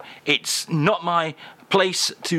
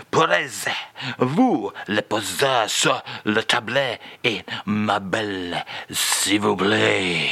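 put-on lower, slow French accent; French accent with feeling; 5 semitones lower than usual. [name] repeats phrase for host, heartfelt French accent. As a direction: lay the French on thick. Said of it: "We're putting some feeling into these today".

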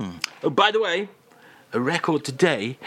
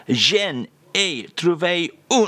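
usual speaking voice

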